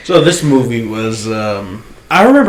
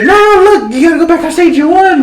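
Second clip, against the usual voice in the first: mock urgent; 4 dB louder, faster than usual. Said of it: "she's like".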